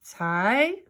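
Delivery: TIE with an "eye" vowel; long I. Shown as a demonstration. Teacher blending the sounds for pupils, TIE with long I. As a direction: blend TIE to rhyme with pie. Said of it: "let's try it the other way".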